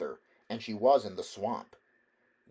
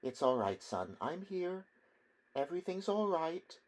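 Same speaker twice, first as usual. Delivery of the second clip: higher, softer voiced old mother; mother's voice; 8 semitones higher, 5 dB quieter than usual. A narrator's reading. Mother hushing her son after his nightmare, soft and high. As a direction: soothe him like a mother at the bedside. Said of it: "he heard her voice there at the bedside".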